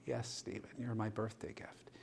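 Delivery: soft, gentle reply; tender. Retold or imitated. Dying patient telling Stephen he is her gift, soft tender voice. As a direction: say it softly, lovingly.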